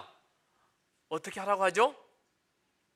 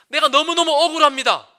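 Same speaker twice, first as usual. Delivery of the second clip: anguished cry; 6 semitones higher, 11 dB louder than usual. Shown as a demonstration. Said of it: "뭐라 해야 됩니까".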